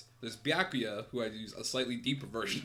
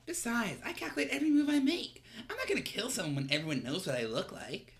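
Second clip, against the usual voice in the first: femme voice; 9 semitones higher, 4 dB louder than usual. Acted. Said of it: "I'm doing a lot of fem voices".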